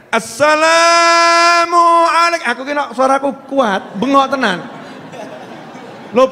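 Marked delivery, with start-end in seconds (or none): 0.1-2.5 high, slow call to the Prophet. Shown as a demonstration. Preacher showing the women how to call the Prophet, high drawn-out call. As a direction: call the greeting out long and high. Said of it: "Bu, teriak".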